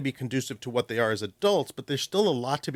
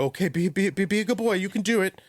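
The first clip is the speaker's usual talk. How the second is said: higher, anxious pleading to the dog; nervous coaxing; 7 semitones higher than usual. Retold or imitated. Owner nervously coaxing reactive dog on leash, anxious pleading. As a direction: coax the dog nervously, voice climbing. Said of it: "I nervously talk to my dog".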